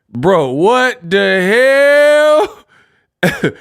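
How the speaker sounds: rising, stretched-out exclamation; loud, high drawn-out disbelief; incredulous. Real, not acted. Streamer reacting to the rapper's yell, incredulous.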